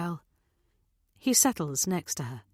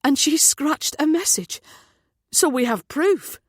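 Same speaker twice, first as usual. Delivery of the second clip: loud, high-pitched excitement; excited realisation; 8 semitones higher, 6 dB louder than usual. A narrator's reading. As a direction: seize on it eagerly, voice raised. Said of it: "Matt's eyes light up".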